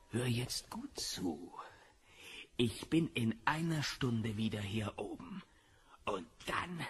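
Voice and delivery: threatening whisper. Acted. Biff quietly threatening Marty to disappear, menacing whisper. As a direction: lean in and hiss the threat.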